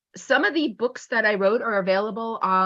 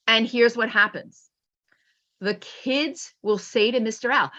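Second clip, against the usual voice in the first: ordinary talk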